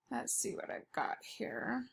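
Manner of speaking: unvoiced whisper to herself; hushed self-talk over notes; whispered aside. Real, not acted. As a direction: breathe it privately as you look. Whispering aside while checking notes.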